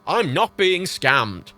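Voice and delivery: slow, emphatic "I'm not being scammed"; pointedly slowed retort; irritated denial. Retold or imitated. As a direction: drag out each word in exasperation. Irritated answer to bank worker.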